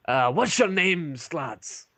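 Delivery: high, slow dwarf question, falling away on "lads"; curious dwarf barkeep. Acted. Dwarf barkeep asks the adventurers their names, high and slow. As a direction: ask their names with a friendly slow lift. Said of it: "Um, and he goes".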